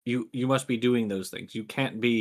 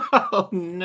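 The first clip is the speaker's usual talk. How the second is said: higher, hammed-up "oh no"; mock dismay; 7 semitones higher, 4 dB louder than usual. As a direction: wail it in playful dread.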